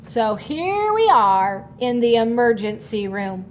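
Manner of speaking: ordinary delivery, nothing marked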